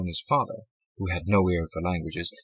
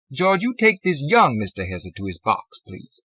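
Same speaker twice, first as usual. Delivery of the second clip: clerk voice; 6 semitones higher, 6 dB louder than usual. A narrator's reading. Clerk to guard.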